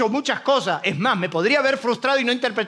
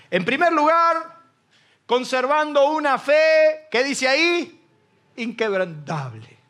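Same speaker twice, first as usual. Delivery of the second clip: raised, fervent preacher voice; preaching; 4 semitones higher, slower than usual. Acted. Pastor preaching to the church, fervent. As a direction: preach it out to the congregation.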